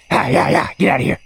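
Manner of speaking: loud yell; scaring off a bear. Real, not acted. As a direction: shout the bear away.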